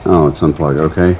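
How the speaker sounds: ordinary talk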